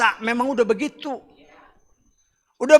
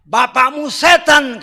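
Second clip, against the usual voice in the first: "Bapakmu setan" slower, louder and higher, a harsh rebuke; 8 semitones higher, 12 dB louder, slower than usual. Shown as a demonstration. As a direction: hurl "Bapakmu setan" slowly and loudly like Yeshua's rebuke. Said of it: "kata Yeshua". ("Bapakmu setan" diucapkan lambat, keras, tinggi seperti teguran kasar)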